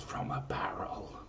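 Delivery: hushed conspiratorial whisper. Acted. Host whispering the omen's punchline to listeners, whisper. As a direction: drop to a secret whisper for the twist.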